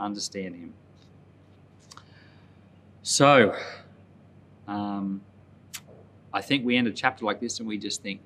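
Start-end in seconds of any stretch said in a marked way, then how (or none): none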